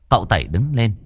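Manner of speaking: ordinary delivery, nothing marked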